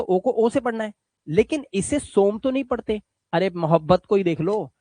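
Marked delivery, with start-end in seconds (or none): none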